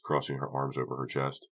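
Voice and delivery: ordinary delivery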